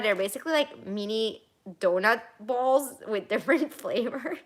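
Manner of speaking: ordinary talk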